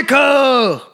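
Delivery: a comic high-pitched shout; shouted movie line. Retold or imitated. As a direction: blurt it out like a famous quote. Blurting the [name] movie line.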